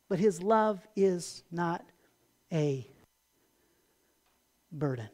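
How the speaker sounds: soft and slow. Real, not acted. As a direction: let not a burden sink to near silence. Soft emphatic close.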